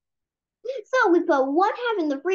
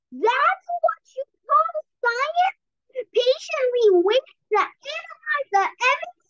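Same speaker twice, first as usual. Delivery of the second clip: a hamster mocking the idea of patient science; scoffing hamster; 10 semitones higher than usual.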